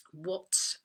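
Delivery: normal talking voice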